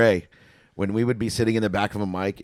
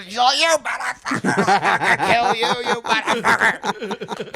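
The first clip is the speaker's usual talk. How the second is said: high, strangled mock screaming; imitated screaming; 11 semitones higher, slower than usual. Drunk bartender carried up the stairs, imitated screaming. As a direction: scream threats as if being dragged away. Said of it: "they carry her up the stairs screaming".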